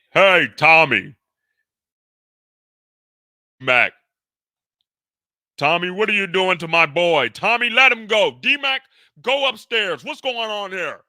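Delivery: high, loud protest at Tommy; alarmed father yelling. Acted. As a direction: shout at him to let the boy go. Father confronts Tommy grabbing son.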